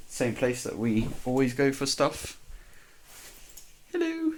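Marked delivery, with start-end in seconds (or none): 3.9-4.3 squeaky sudden greeting; high-pitched hello. Real, not acted.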